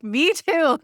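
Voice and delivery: high, laughing reply; laughing relief. Retold or imitated. Answering surprised service manager, laughing high relief. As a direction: giggle it out, relieved.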